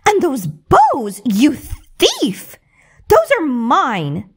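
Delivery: high, loud, slow shriek of accusation; hammed-up shrieking stepmother. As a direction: screech at the thief. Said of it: "shrieked the stepmother".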